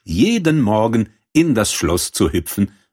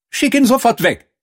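high-pitched princess command; indignant princess; 4 dB louder than usual. A narrator's reading. Princess orders the servant to send the frog away, high indignant command. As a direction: dismiss him in a very high voice.

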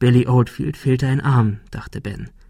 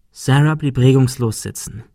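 usual speaking voice

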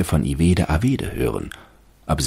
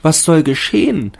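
fir tree thinking; 13 semitones higher, 6 dB louder than usual. A narrator's reading. Fir tree watches children plunder it, high anxious voice. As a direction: wonder fearfully what is happening. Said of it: "dachte der Baum".

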